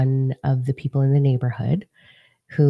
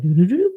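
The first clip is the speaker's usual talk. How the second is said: rising, higher doo-doo-doo; quick sung-out electronic start-up noise; imitated speaker chime; 4 semitones higher than usual. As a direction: mimic a speaker powering on. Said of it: "you hear a speaker go on".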